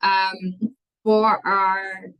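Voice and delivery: usual speaking voice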